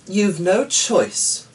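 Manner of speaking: high, slow, firm insistence; a lightly voiced daughter laying down the law; insistent daughter. A narrator's reading. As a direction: daughter insisting her father has no choice.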